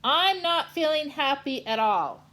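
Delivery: loud high whine; loud sulky complaint; unhappy Kansas. Acted. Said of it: "Because he said".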